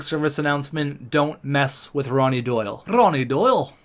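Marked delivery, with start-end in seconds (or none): 2.9-3.7 higher, rolled-R attempt at Scottish; bad Scottish accent. Joke warning about Ronnie Doyle, bad Scottish accent. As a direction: do a badly faked Scottish accent. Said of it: "I don't know how to do a Scottish accent".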